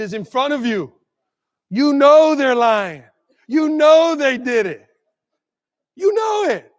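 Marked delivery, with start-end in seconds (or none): 1.7-6.7 building to a shout. Real, not acted. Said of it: "building to a shout".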